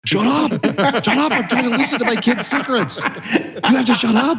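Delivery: laughing mock shout-whisper at his wife; mock frantic shushing. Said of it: "I was like".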